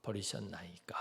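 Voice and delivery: whispered, breaking off; anguished whisper. Pretend. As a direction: let the question die into a whisper. Jesus's last words on cross.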